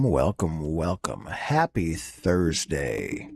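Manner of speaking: like a voice changer; deep voice effect. Acted. Effect-processed low voice.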